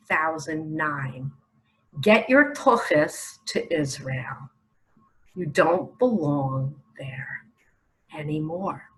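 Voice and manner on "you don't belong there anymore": whisper. Acted. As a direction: breathe it out like a secret warning. Delivering God's message to Americans.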